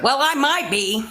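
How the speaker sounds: usual speaking voice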